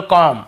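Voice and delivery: कॉम said as kom with no b at the end; silent b. Shown as a demonstration. Teacher giving the correct reading, b silent. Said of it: "बी को नहीं पढ़ना".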